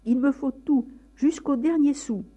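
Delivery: high, loud, seething demand; hateful shout. Acted. Father insisting on every last coin, shouting with hatred. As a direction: spit it out trembling with hate. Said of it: "Il frémissait de haine".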